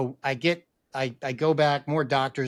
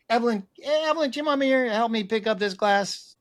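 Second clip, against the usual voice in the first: higher-pitched call to his daughter; 7 semitones higher than usual. Retold or imitated. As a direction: call across the house for help, retold. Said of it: "I called out to my daughter".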